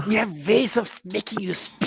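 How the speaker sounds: a lightly voiced sinister interrogator; German interrogator. Woman in leather greets the visitor, mock menacing. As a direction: menace it out like an old war film villain. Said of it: "blonde woman wearing leather saying".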